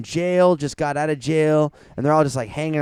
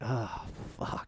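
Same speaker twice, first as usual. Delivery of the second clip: breathy whispered oh fuck, much softer; mock blissed whisper; 4 semitones lower, 17 dB quieter than usual. Describing floating bliss to a friend, whispered. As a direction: let it slip out in a whisper.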